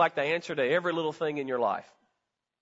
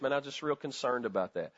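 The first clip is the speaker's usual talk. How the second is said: softer, gentle worried tone; caring concern; 6 semitones lower, 7 dB quieter than usual. Acted. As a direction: say it low like you truly care. Voicing a caring one-on-one talk.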